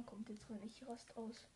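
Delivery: unvoiced whisper. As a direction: whisper it so grandma can't hear. Grandma might walk in.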